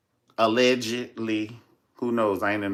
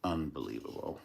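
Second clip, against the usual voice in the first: a low, soft unbelievable; quiet disbelief; 8 semitones lower, 13 dB quieter than usual. Real, not acted. Reacting to the grandmother story, a low soft disbelief. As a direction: say it under your breath, stunned.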